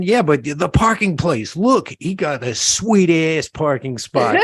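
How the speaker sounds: lightly voiced New York guy. Guy outside the deli praising a parking spot, in a light New York voice. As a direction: play a New Yorker admiring a parking spot. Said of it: "here's the conversation".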